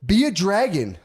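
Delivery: a bright dad voice on a video call; cheery dad. Retold or imitated. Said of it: "I call 'em like".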